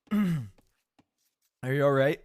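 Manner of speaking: slow, strained words between coughs; croaky apology still half choking; choked up after coughing. Real, not acted. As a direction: talk through a coughing fit. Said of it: "coffee went down the wrong way".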